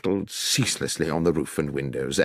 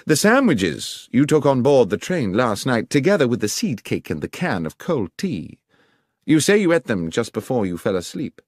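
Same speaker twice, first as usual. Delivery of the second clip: lightly voiced detective, pitched higher; higher-pitched investigator taking charge; brisk detective; 6 semitones higher, 4 dB louder than usual. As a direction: give orders as a commanding detective. Voices Holmes questioning the guard.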